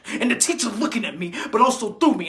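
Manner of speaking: usual speaking voice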